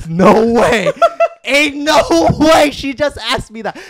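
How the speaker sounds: loud, high, laughing shout; laughing disbelief. Real, not acted. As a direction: laugh it out, can't believe it. Laughing disbelief at girl's question.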